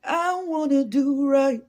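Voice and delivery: mock dramatic plea. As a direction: ham up a tearful confession. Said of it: "I play too much".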